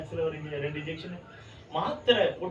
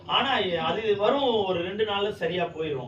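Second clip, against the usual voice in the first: slow, louder and higher preaching; forceful preaching; 3 semitones higher, 4 dB louder, slower than usual. Acted. Preacher telling the church of his son's headache, preaching with force. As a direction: drive each phrase home from the pulpit.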